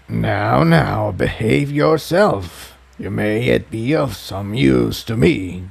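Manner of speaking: a lightly voiced sly villain, slow and cold. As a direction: hold a menacing, condescending villain tone, slowly.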